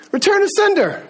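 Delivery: high-pitched send-off; emphatic dismissal. Retold or imitated. Dean dismissing Mister Satan's letter, emphatic rejection. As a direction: send it back with a high, decisive call.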